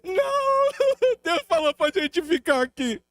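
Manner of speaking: wife insisting they stay, crying and laughing; crying through it. Retold or imitated. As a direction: wail it through tears and a laugh. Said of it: "Minha esposa falou assim".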